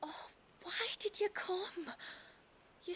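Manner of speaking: crying, voice breaking; tearful. Acted. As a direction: say it close to tears. Tearful reunion.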